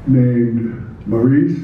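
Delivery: usual speaking voice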